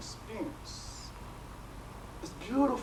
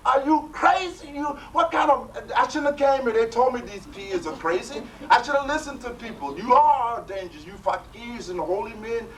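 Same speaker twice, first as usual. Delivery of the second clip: high, loud, outraged ranting; indignant seeker berating the holy man, loud and high; furious outburst; 8 semitones higher, 6 dB louder than usual. Retold or imitated. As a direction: storm at the teacher as a madman. Seeker furious after near drowning.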